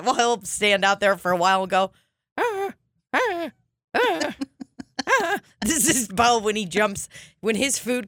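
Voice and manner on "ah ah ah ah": high yappy barks; imitated dog bark. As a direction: yap like a small dog.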